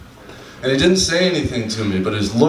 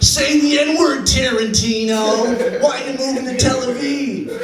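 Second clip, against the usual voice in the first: much higher, slow, strained mock heckler voice; heckling woman; 13 semitones higher, slower than usual. Comic mimics a woman heckling Tarantino at dinner, high mock shriek. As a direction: squeal it out like an outraged heckler, drawing out the name. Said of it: "she goes".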